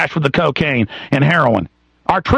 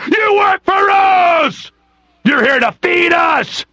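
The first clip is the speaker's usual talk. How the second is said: drawn-out bellow climbing to a high, strained shout; drill sergeant; 9 semitones higher, much slower than usual. Retold or imitated. Cops lording it over the public, hammed-up drill-sergeant shouting. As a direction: imitate a tyrant building into a slow scream. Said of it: "it's all drill sergeant psychology".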